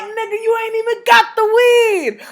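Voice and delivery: high, slow, yelling put-down; high-pitched slow scoff of a shout; mocking shout. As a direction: shout him down in disbelief. Roasting guy who has no weed.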